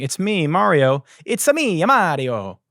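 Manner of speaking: high bouncy Italian "It's-a me"; hammed-up Italian plumber; Italian Mario voice. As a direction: sing it out like cartoon Mario. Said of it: "they gave him a super Italian voice".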